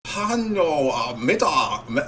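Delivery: high, halting mock German accent; clumsy put-on German accent. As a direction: parody a German speaking broken English. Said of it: "If I was talking like Chinese".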